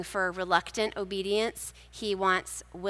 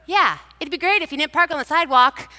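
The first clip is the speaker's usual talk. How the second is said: higher, louder mock-polite jab; sweetly sarcastic at a passerby; sarcastic call to the last pedestrian; gently sarcastic; 7 semitones higher, 7 dB louder, faster than usual. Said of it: "in a gently sarcastic way".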